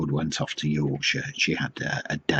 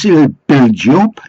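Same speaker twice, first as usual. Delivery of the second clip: loud, high put-on Geordie; mock Geordie accent; 7 semitones higher, 10 dB louder than usual. Fish seller persuades a reluctant householder, mock Geordie. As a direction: push the sale in broad mock Geordie. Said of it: "I don't think it was a genuine accent".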